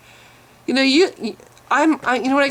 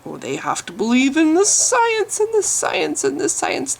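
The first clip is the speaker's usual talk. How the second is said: mocking parody; 6 semitones higher than usual. Mocking the opponent's claimed belief.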